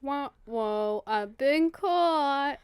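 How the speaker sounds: long drawn-out, rising mock dismay; mock caught-out reaction. Co-host playing the king found out, drawn-out mock dismay.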